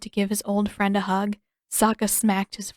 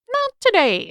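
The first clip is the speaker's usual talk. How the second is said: much higher, louder sudden squeak; manic squeak; 13 semitones higher, 6 dB louder than usual. A narrator's reading. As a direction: shoot up into a crazy squeal. King's sudden high squeak.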